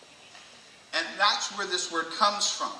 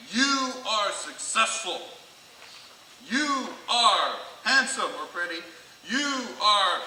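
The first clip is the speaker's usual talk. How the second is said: slow, deliberate mirror pep talk; self-help affirmation; 2 semitones higher, slower than usual. Retold or imitated. Person affirming themselves in the mirror, slow and emphatic. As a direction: space out each word like a self-help tape. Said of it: "self-help books".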